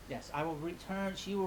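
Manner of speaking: ordinary talk, nothing marked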